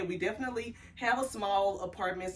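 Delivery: normal talking voice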